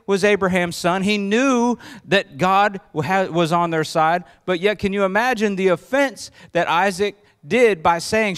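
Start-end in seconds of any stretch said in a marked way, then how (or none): none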